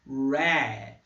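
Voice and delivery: red said with an open E like é; teacher showing the E in red; English word demo. Shown as a demonstration. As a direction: model red with the é sound. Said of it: "como, por exemplo".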